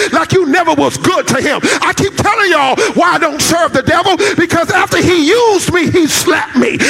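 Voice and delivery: a pastor driving the message home to the congregation; full-force preaching. Acted.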